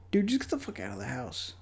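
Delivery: ordinary delivery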